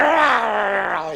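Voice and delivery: high, loud, drawn-out dog snarl; dog growl. Acted. Dog warning off the castaway, long loud growl. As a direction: let out a long growl that slides down. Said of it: "Dog went".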